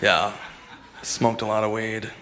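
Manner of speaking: usual speaking voice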